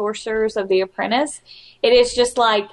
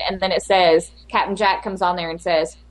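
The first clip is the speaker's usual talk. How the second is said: normal talking voice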